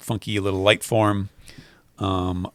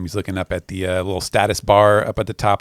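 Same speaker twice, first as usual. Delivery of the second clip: normal talking voice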